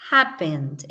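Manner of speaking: happened starts at the top and drops; strong HAP, then let happened fall; HAP-pened, descending; stress on first syllable. Shown as a demonstration. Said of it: "empieza desde arriba y va bajando".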